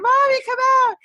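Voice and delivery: high-pitched mock child plea; imitating scared child. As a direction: call out like a frightened little girl. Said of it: "girls are scared out of their wits".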